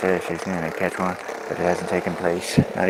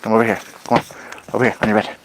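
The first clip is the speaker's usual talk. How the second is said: loud, higher commands to the dog; real loud call to a pushy dog; ordering the dog; 4 semitones higher, 5 dB louder, much faster than usual. Real, not acted. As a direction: send the dog to its bed, loud and firm.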